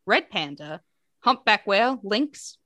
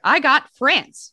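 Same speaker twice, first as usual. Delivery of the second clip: higher, louder, slow reveal of France; excited reveal; 7 semitones higher, 7 dB louder, much slower than usual. Real, not acted. Announcing the quiz country to co-host, excited slow reveal. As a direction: savor the big result.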